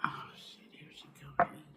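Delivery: hushed panicked whisper; whispered warning as Mama comes. Real, not acted. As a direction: whisper it so Mama won't hear.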